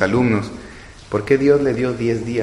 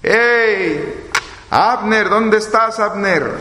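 high, carrying call across distance; calling out from afar; 9 semitones higher, much slower than usual. Retold or imitated. David calling to Abner from a distant hill, high and carrying. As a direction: shout up from the hill to wake him. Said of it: "les empezó a gritar David".

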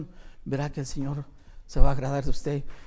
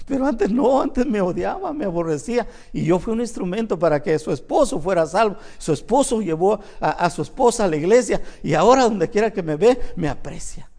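ordinary talk

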